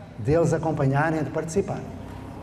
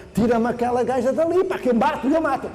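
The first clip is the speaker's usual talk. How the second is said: a higher, exasperated mock outburst; exasperated colleague; 6 semitones higher than usual. Colleague begging him to remove Dona Glória, higher exasperated mock outburst. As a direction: play the fed-up colleague ready to snap. Angry colleague demands help.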